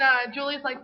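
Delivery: usual speaking voice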